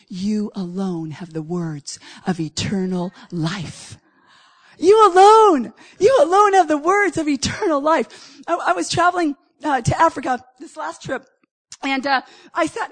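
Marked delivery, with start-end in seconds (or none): 0.1-4.0 slow, soft and low, like a hushed confession; quiet reverent quote. Retold or imitated. Peter answering Jesus, slow hushed and reverent. 4.8-8.4 louder, higher, punching "alone"; loud proclamation. Real, not acted. Preacher proclaiming the line back to his congregation, loud.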